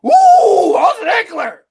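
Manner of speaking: loud, high mocking whoop; mock excitement. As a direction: imitate your co-host gushing over a player. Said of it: "then you carry on about".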